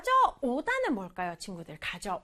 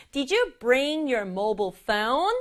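slow, with "bring" and "mobile phone" leaned on; slow model read, stressing "bring" and "mobile phone"; much slower than usual. Shown as a demonstration. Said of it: "mobile phone 강조해주면 돼요".

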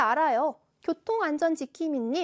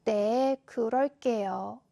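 low, slow, humble answer; accepting, apologetic; 4 semitones lower, much slower than usual. Shown as a demonstration. Seulbi answering the homeroom teacher's advice, sorry and accepting. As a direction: admit it meekly, drawing the words out. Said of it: "인정하는 말투".

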